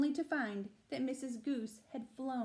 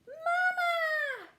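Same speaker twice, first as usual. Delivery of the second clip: put-on tiny high-pitched baby bird cry, loud; gosling squeal; 9 dB louder than usual. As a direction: squeal it like a just-hatched gosling calling its mum. Goslings hatch, call bear mother.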